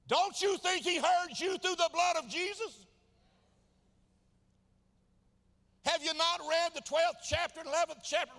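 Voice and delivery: high, loud, driving sermon delivery; full-force preaching. Real, not acted. Preacher challenging those who keep asking, full-force preaching. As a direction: hammer the questions home at the top of your voice.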